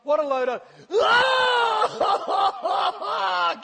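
dismissive words exploding into high, loud sobbing wail; sudden mock bawl. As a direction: snap from scorn to hammed-up bawling. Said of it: "It went from".

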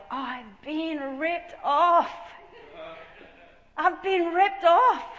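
slow, drawn-out loud cry of realization; indignant outcry. Retold or imitated. She sees herself in the vision, indignant outcry. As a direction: stretch each word out and call it loudly.

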